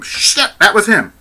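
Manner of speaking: high, loud acted outburst; imitated shocked exclamation; shocked realization. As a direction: blurt it out high as the truth hits. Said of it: "you can just hear her thought process".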